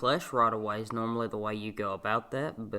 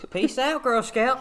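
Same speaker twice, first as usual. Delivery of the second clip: high playful sing-song; 12 semitones higher, 4 dB louder than usual. Pretend. Joking exit line.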